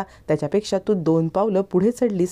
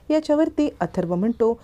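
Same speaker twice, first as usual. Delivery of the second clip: plain reading voice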